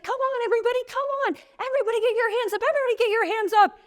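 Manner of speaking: put-on a little boy cheering everyone to stand, fast and high; kid cheering the crowd. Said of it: "He starts cheering".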